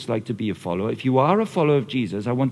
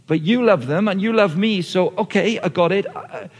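higher, louder, eager talking to Jesus; imagined disciple praying; 4 semitones higher, 4 dB louder than usual. Acted. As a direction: act out a nervous believer relaxing. Voicing a disciple's prayer to Jesus.

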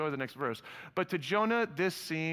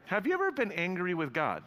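normal talking voice

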